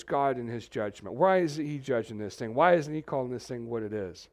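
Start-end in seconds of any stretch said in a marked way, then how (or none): none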